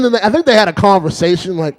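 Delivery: ordinary talk, nothing marked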